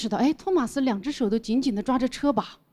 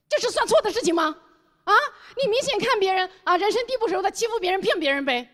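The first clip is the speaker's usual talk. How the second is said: indignant retold scolding; 8 semitones higher than usual. 当面质问老板.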